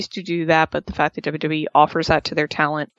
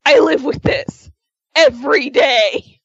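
raised, higher voice, acting fed up; mock exasperation; 8 semitones higher, 5 dB louder than usual. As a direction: play a wife complaining to onlookers, mildly hammed-up.

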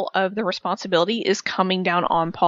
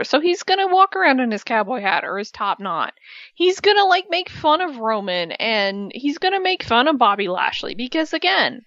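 ordinary delivery

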